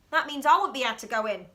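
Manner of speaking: put-on girl doctor realising she's shut out; fast dismayed line. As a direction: blurt out the worry.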